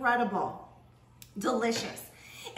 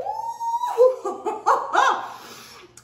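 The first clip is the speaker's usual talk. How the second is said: high squealing laugh of delight; delighted shriek of laughter; 8 dB louder than usual. Real, not acted. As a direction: burst out in a loud, high laugh. Big reaction to first taste.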